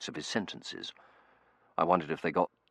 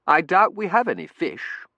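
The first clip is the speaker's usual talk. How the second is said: higher, louder character voice; 12 semitones higher, 10 dB louder than usual. A narrator's reading. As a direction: lift pitch and volume for the character. Character's line acted in narration.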